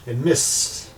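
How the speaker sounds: ordinary talk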